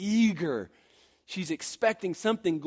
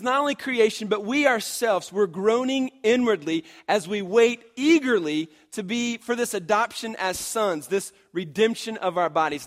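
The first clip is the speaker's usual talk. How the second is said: emphatic scripture paraphrase; 4 semitones higher, 5 dB louder than usual. Acted. Scripture re-read.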